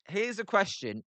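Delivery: usual speaking voice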